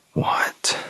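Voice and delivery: hushed, voiceless disbelief; stunned whisper. Retold or imitated. Newcomer overwhelmed by congratulations, stunned whisper. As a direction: whisper it as if dumbfounded.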